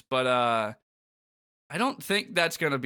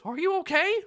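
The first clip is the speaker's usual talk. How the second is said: Mickey Mouse voice; 3 dB louder than usual. Acted. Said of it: "Mickey says".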